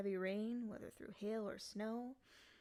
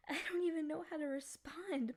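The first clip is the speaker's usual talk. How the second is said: high, louder breathless whisper; overwhelmed whisper; 7 semitones higher, 5 dB louder than usual. Acted. Freed demon whispering, overwhelmed, to its rescuer.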